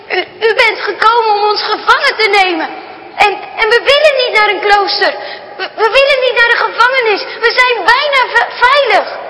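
high, stammering, on the edge of tears; frightened girl pleading with a stranger; tearful pleading girl. Acted. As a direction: beg him not to take them, voice catching. Girl pleads, fears capture.

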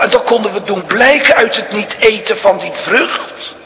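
ordinary talk

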